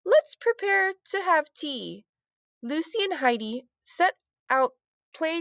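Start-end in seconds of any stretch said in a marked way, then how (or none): 0.0-2.0 put-on high, slow proper English accent. A narrator's reading. Lucy playing princess before tea, slow English accent. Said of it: "in the same accent".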